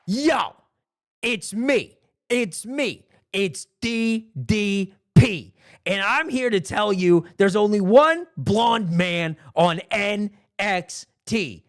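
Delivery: high, drawn-out wrestler promo voice; Diamond Dallas Page. Acted. Wrestler announcing himself to the audience, slow hyped promo. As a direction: stretch each letter for the crowd, with pauses. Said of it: "Diamond Dallas Page comes out and he goes".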